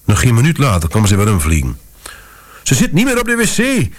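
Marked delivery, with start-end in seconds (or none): 2.6-3.9 high panicked shriek. A narrator's reading. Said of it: "gierde ze". (hoog gierend van paniek)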